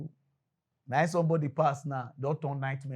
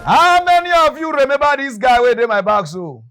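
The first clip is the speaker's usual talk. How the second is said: much higher and louder, ringing out; calling out; 9 semitones higher, 16 dB louder than usual. Real, not acted. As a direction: call to the whole crowd. Crowd call-out.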